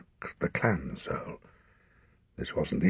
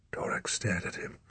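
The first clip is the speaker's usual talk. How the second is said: ordinary delivery, nothing marked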